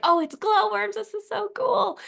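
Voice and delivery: high, thrilled retold exclamation; giddy, high-pitched wonder; excited amazement. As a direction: reenact a delighted discovery in a high voice. Retold delight at finding glowworms.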